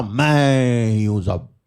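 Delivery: a slow mock boast of a petty tyrant; mock arrogant boast. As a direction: lightly play the puffed-up king of the house. Mocking the arrogant household tyrant.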